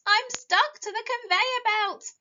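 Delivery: high, loud, worried carrot voice; distressed carrot. A narrator's reading. Carrot stuck on the belt, high and upset. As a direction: squeak out the trouble, loud and high. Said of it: "cried Carrot".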